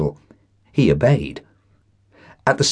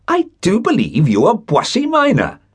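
higher, excited cry of recognition; light up as you recognise an old schoolmate; pitched-up surprise at a familiar face; delighted recognition; 12 semitones higher than usual. Acted. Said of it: "cast violently out of a disagreeable excitement".

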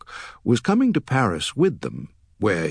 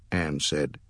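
usual speaking voice

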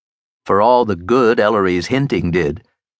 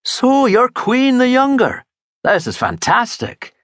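high, delighted exclamation; a lightly voiced Scottish detective, amazed; Burke's voice; 14 semitones higher than usual. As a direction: sound thrilled to meet him. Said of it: "Burke said".

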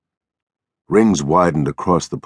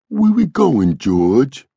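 put-on high, childlike question; timid Lennie; 10 semitones higher, 3 dB louder, slower than usual. A narrator's reading. Lennie asking George where they go, timid. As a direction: ask it meekly, voice lifting.

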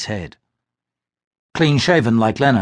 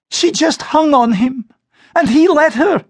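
much higher, slow, wounded complaint; hurt young woman; 4 dB louder than usual. Acted. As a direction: tell mother what she saw, stung. Glynis complains to mother.

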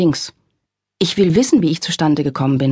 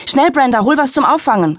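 higher, louder urgent call; grandfather calling out; 8 semitones higher, 3 dB louder than usual. A narrator's reading. Grandfather urgently calling to Brenda, higher and loud. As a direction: call for help across the room. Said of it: "rief mein Großvater".

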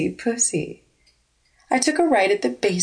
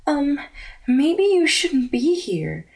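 hesitant, higher, slow caution; a lightly voiced nervous friend warning; careful slow warning, a bit higher; uneasy warning; 6 semitones higher than usual.

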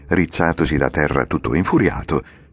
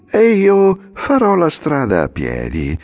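high, slow sly coachman voice; a hint of false self-sacrifice; little man, mock humble; 12 semitones higher, 4 dB louder, slower than usual. The coachman answers. (l'omino falsamente umile, lento)

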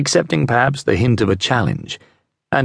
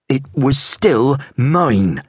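slow, higher, insisting; stubborn apple seller; 4 semitones higher, much slower than usual. Acted. Apple seller claiming her apple, slow and stubborn. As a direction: drag it out, claiming what's hers.

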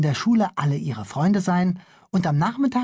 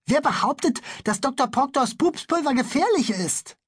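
put-on indignant boy; 10 semitones higher than usual. Bülle challenges the police.

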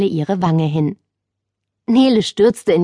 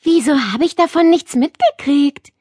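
put-on surprised girl; 8 semitones higher than usual. A narrator's reading. Nele asks, surprised.

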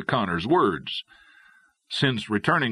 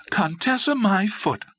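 high-pitched, dismissive cut-in; scornful interruption; 10 semitones higher than usual. A narrator's reading. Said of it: "Dolly interrupted".

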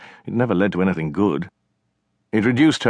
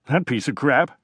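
cocky brother; 6 semitones higher than usual. Acted. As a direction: taunt her like a smug kid brother. Narrator voices the brother.

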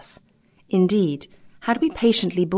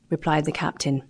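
ordinary delivery

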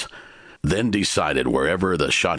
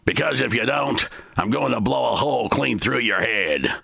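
gunman's threat; 8 semitones higher than usual. A narrator's reading.

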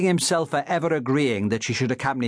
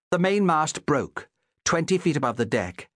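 usual speaking voice

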